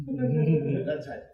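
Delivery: usual speaking voice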